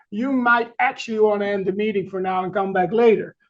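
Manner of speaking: ordinary talk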